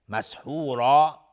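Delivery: مسحورا with the final alif drawn out long; elongation demo. Shown as a demonstration. Teacher demonstrating the substitute madd, slow. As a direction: hold the end of مسحورا as a long aa. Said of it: "مد عوض".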